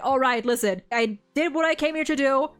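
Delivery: usual speaking voice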